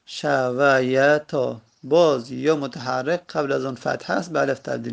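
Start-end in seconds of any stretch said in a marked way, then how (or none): 0.1-1.6 very slow, each syllable drawn out; شمرده و هجاهجا. Shown as a demonstration. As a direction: break شویتا into long, separate syllables. Teacher repeats form syllable by syllable. (خیلی آهسته، کشیده و هجاهجا)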